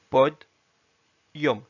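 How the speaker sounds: pod and yom said apart with a clear pause; split syllables. Shown as a demonstration. Teacher shows podium split in two. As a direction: break pod from yom distinctly. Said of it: "bien prononcer pod, yom, distinctement".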